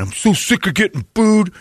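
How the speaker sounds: high-pitched, put-on whiny husband voice; mock him as a tearful, sorry-for-himself guy; whiny husband impression. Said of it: "a wife who's seen her husband go through this".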